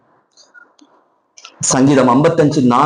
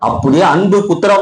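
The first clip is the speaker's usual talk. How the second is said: usual speaking voice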